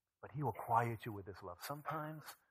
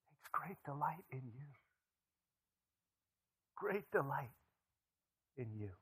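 breathy whispered reassurance; hushed whisper; 6 semitones higher than usual. Acted. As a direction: whisper it like a secret you treasure. Tender aside on God's delight.

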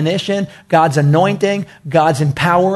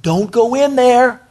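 alarmed warning; 3 semitones higher than usual. Retold or imitated. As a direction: imitate scared spies warning everyone back. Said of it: "some came out and said".

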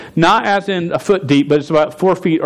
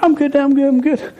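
put-on nervous kid; 10 semitones higher than usual. Scared boy refuses dad.

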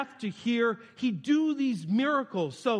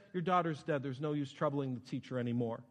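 subdued bad news; 7 semitones lower, 6 dB quieter than usual. Servant telling Jairus his daughter has died, subdued. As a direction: lower and softer, trailing down.

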